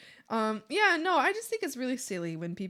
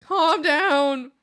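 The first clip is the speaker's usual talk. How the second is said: exasperated plea; 3 semitones higher, 9 dB louder, much slower than usual. Retold or imitated. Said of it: "it's just like".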